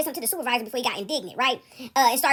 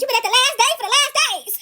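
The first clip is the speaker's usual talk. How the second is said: higher, louder laughing mock voice; teasing viewer; 8 semitones higher, 5 dB louder than usual. Teller voicing a viewer teasing her, laughing mock. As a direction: toss it off like a teasing commenter. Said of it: "Somebody said".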